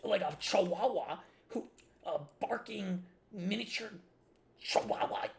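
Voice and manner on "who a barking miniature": ordinary talk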